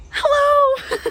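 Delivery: high, loud bright hello; eager hello. Retold or imitated. As her idol turns back, a high loud hello. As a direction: burst out a starstruck hello.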